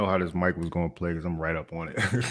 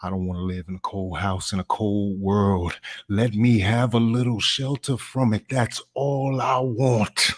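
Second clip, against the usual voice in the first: slow, heartfelt plea with sudden loud punches; impassioned dramatic monologue; 4 semitones higher, 4 dB louder, slower than usual. Acted. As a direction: draw the words out, swelling on key words. Audition monologue performed.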